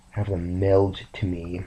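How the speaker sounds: ordinary delivery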